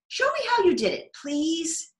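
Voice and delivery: very high pleading voice; begging girl. Acted. Becky begging Davy to teach the trick, very high plea. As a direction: beg him to show you.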